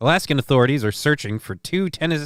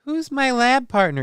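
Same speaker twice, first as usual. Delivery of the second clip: put-on whiny kid voice; 11 semitones higher than usual. Voicing a schoolkid.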